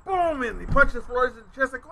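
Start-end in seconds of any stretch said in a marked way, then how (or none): none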